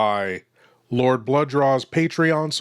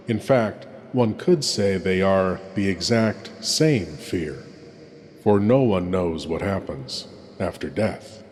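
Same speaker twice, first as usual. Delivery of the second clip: hushed horror host; 2 semitones lower than usual. Acted. Horror host introduces tonight's tale.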